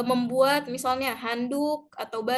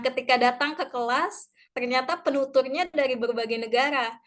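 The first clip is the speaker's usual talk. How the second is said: normal talking voice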